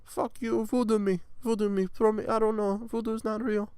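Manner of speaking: high put-on victim voice; defiant victim. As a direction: plead and scoff in a high register. Acts defiant victim answering threats.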